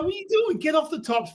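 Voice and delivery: high, exasperated retold telling-off; mock scolding. Pitcher ordering the kid off the top step, mock scolding. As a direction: pitch it right up in mock disbelief.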